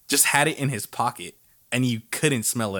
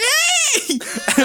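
imitating teacher's very high holler; high, drawn-out call from a passing car; yelled hello; 4 dB louder than usual. Teacher honking at students in park, yelled hello. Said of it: "Who's honking and yelling?".

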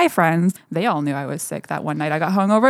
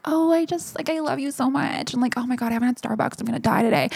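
put-on higher, softer gushing girly voice; mock gushing girlfriend, higher and softer; imitating girlfriend Lauren; 5 semitones higher, 5 dB quieter than usual. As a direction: gush like a bubbly girlfriend. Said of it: "I talk very differently".

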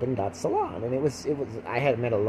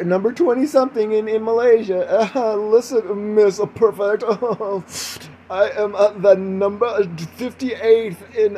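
hammed-up self-important braggart; mock pompous boasting; 9 semitones higher, 5 dB louder than usual. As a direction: gloat grandly, drawing words out with laughs. Mock pompous boasting about chart rankings.

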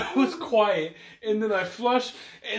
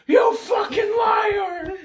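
slow, much higher, hammed-up shout; screamed; 13 semitones higher, 3 dB louder, much slower than usual. Retelling how the stranger screamed at him, mock shout. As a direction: scream the accusation, stretched out. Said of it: "he screamed".

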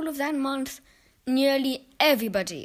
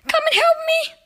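high, loud desperate cry; boy silently begs grandma to help him; frightened plea; 9 dB louder than usual. Acted. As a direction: call for grandma in terror. Terrified boy calls for help.

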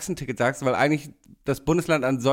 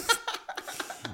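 high, breathy burst of laughter; laughing; 9 dB quieter than usual. Real, not acted. Host laughing about bored games alone at home, laughing. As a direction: laugh high and soft after your own line.

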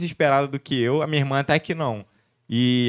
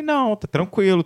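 mock-calm new dad; 9 semitones higher than usual. Dad telling doctors he's fine.

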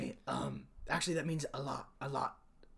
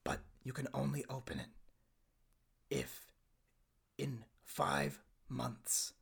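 soft, low, slow with heavy pauses; solemn instructions; 4 semitones lower, 10 dB quieter, slower than usual. Acted. Son telling Dad when to open the box, solemn. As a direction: weigh each phrase, hushed.

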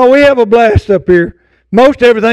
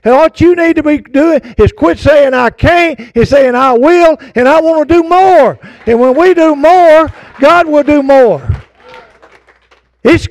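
higher-pitched, driving sermon delivery; fervent preaching; 4 semitones higher than usual. Real, not acted. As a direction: preach it to rouse the congregation.

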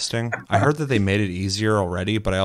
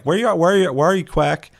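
high, loud calling for a lost pet; imitating Sarah calling for her pet, high and loud; frantic calling; 9 semitones higher, 4 dB louder than usual. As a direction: call out high for the dinosaur. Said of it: "I see Sarah come running out".

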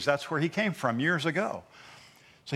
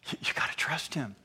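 voiced-off whisper of trust; urgent whisper; 6 dB quieter than usual. Real, not acted. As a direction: drop to a whisper to press it home. Preacher urging congregation.

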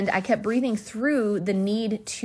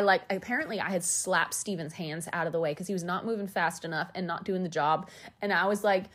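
usual speaking voice

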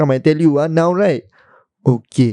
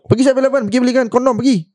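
higher, bossy mock command; mock order; 6 semitones higher, much faster than usual. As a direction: shoo the assistant off to the shop. Boss sends assistant for condoms.

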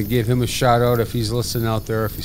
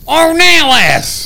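high, long-drawn shout of a name; hollered greeting; 11 dB louder, much slower than usual. Retold or imitated. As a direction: holler it out as if spotting an old buddy. Old buddy hollering in recognition.

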